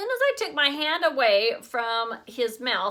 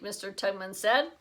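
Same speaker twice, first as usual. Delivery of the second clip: ordinary delivery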